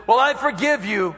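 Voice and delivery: hammed-up begrudging pardon; grudging forgiveness. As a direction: forgive with a warning finger. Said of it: "the kind of attitude that".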